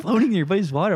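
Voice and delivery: incredulous laughing repeat; laughing disbelief. Retold or imitated. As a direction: echo it back, cracking up in disbelief.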